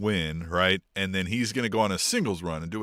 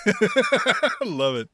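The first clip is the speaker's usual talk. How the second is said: laughing; 10 semitones higher, much slower than usual. Real, not acted. Laughing after the quote.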